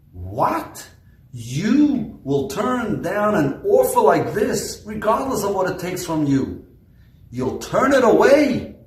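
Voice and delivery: high, loud incredulous outburst; flabbergasted disbelief. Retold or imitated. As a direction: demand in shock how he could refuse. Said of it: "He was flabbergasted".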